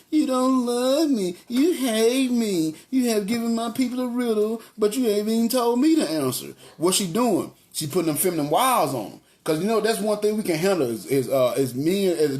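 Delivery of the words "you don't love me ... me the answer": milk it like a tearful guilt trip; whiny tearful wife. Acted. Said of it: "This is how I imagine her talking".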